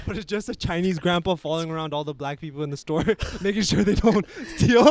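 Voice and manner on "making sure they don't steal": laughing. Real, not acted. Laughing at his own joke.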